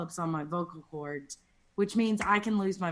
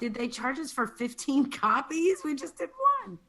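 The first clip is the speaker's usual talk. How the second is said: mock shock; 7 semitones higher than usual. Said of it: "I was like".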